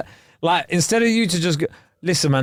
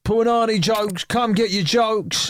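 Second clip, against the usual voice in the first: high, slow street-vendor call; mock market trader shouting his wares; market hawker call; 7 semitones higher, slower than usual. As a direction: drag it out like selling at a stall. Hawking jokes like a vendor.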